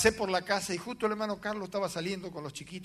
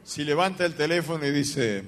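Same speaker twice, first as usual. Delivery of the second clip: ordinary talk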